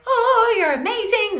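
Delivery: high-pitched mock fan squeal; gushing admiration. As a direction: swoon like an adoring follower. Acting out gushing followers.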